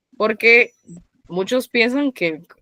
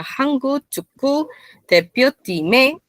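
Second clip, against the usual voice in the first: "Hangu, Chukku, Tepyo, Time" in raised two-syllable beats; rule-based intonation; 7 semitones higher, slower than usual. Shown as a demonstration. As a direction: pitch up and pause after each of "Hangu", "Chukku", "Tepyo", "Time". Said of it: "Si yo lo pronuncio con las reglas".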